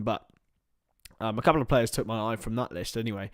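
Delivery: ordinary delivery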